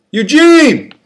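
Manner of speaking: high, loud yell across the tier; friend screaming. Acted. Friend in a cell calls to the escorted prisoner, loud scream. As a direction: scream his name from a cell. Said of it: "screamed a friend".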